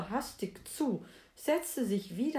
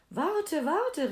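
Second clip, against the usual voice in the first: high, urgent frog call; storyteller's raised-pitch cry of a frog left behind; 9 semitones higher, 5 dB louder than usual. A narrator's reading. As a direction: call after someone to wait. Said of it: "rief der Frosch".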